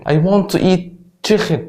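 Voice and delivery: "chicken" said as chikhen; throaty kh inside "chicken"; Scouse middle k as kh. Shown as a demonstration. Teacher giving a Liverpool example sentence, "chicken" with kh in the middle. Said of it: "يتنطق خ في نص الكلمه".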